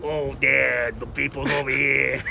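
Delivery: a New York accent, tossed off as a quick bit; old school New York accent. Shown as a demonstration. Host showing off his New York accent on stream. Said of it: "an old school New York accent".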